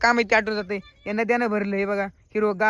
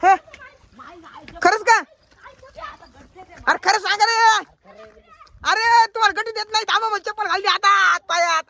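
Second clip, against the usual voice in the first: excited calling; 10 semitones higher than usual. Real, not acted. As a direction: call out eagerly while searching. Excited calling while spotting rabbit.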